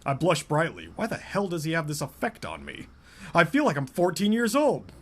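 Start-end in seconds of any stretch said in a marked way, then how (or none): none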